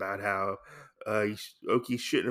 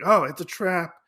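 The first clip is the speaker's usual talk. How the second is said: higher, louder retold exclamation; mock alarm; 7 semitones higher, 5 dB louder than usual. Retelling the heroes spotting an ambush, mock alarm. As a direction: cry out in playful alarm.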